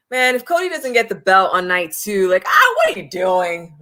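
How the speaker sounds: half-shouted frustration at the booking; retold exasperated outburst at the TV; exasperated yell. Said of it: "because I was like".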